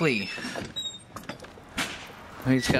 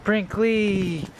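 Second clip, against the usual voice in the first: calling the dog; 9 semitones higher than usual. Real, not acted. Spots dog and calls him.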